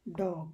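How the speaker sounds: ডগ whispered with an English D; soft English-style ডগ; quiet English dog; English pronunciation. Shown as a demonstration. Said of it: "উচ্চারণ করি এভাবে".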